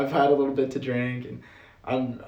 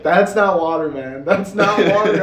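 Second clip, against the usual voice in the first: louder, pushed insistence on the reveal; emphatic roommate quote; 5 semitones higher, 9 dB louder than usual. Retold or imitated. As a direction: insist it wasn't water, half laughing. Roommate reveals backpack truth.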